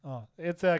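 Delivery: ordinary talk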